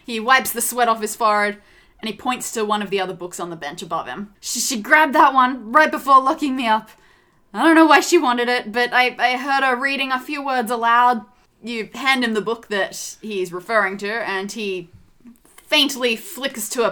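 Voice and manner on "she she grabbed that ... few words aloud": louder, slightly agitated stammer; shaken doctor. A narrator's reading. Slapped doctor pointing rescuers to the book, mildly shaken. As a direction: explain hurriedly, a touch of panic left.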